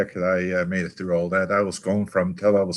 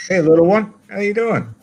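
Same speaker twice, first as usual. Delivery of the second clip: greeting a little one; 10 semitones higher, 4 dB louder than usual. Real, not acted. Greeting a little child who appears on the call, high and sing-song.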